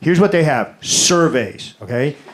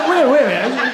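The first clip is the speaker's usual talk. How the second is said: high, surprised wait a minute; mock surprise; 6 semitones higher, much faster than usual. Real, not acted. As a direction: pull up short, higher. Stunned by essay portion.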